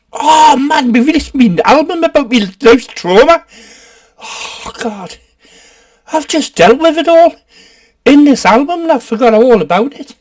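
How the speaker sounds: high, loud, overdone lament; mock anguished complaint. Singer upset about his forgotten birthday, mock anguished wail. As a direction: wail it as a hammed-up grievance.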